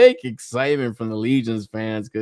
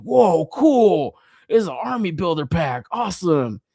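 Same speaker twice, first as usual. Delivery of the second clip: excited self-quote; 6 semitones higher than usual. Retold or imitated. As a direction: act out delighted discovery. Re-enacting excitement at army builder.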